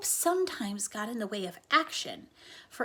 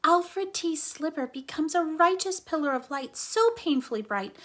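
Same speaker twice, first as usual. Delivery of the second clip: higher, louder, building awe; excited; 5 semitones higher, 5 dB louder than usual. Acted. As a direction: announce the hero with big excitement. Flora hypes the superhero's glory.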